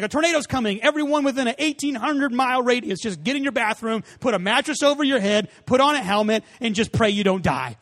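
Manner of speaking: playfully exaggerating the old warnings; mock alarmist warning. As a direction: overdramatic emergency broadcast. Mock old-school tornado warning.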